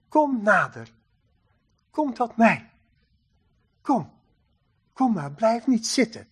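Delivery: soft, tender invitation with room between phrases; gentle beckoning. Acted. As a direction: coax them quietly to come closer. God gently beckons people near. (zacht en teder wenkend)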